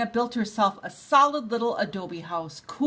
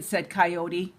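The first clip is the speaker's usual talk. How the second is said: usual speaking voice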